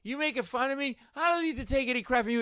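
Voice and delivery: high, mildly heated challenge; angry confrontation. Retold or imitated. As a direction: imitate an offended barfly picking a fight. Bleeding man confronts the comedian.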